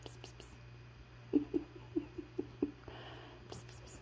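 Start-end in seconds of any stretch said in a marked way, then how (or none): none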